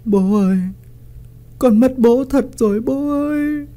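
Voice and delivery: high choked crying for her father; sobbing grief. A narrator's reading. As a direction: cry out brokenly to the dead father. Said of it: "khóc nấc lên ... mà nghẹn ngào". (con gái nghẹn ngào khóc bố, giọng cao)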